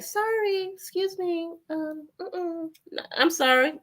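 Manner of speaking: high, soft, overly polite voice; mock meek apology. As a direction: shrink down and apologise sweetly. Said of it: "I gotta just be like".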